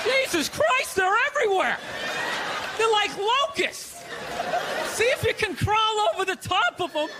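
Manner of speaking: high, amazed mock panic; mock alarm. Comic to audience, mock alarm. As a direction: gasp at imaginary swarms of kids. Mocking fat kids everywhere.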